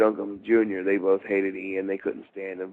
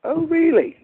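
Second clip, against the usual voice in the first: very high, louder gasp of disbelief; mock surprise; 6 dB louder than usual. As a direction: Steve Austin's wife hearing he's from Baltimore, high mock surprise. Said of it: "She goes".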